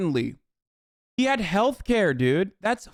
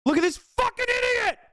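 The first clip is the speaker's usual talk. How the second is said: outraged yell; 13 semitones higher than usual. Real, not acted. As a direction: pitch shoots way up on idiot. Spots a chatter, yells at him.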